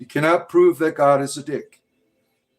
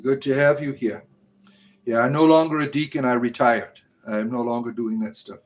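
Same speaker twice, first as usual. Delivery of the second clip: ordinary talk, nothing marked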